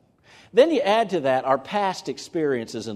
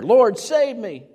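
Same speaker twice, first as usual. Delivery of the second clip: high, loud, slow plea; Peter's short panic prayer to the Lord; 9 semitones higher, 7 dB louder, slower than usual. Retold or imitated. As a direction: beg to be pulled out. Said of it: "God answers panic prayers".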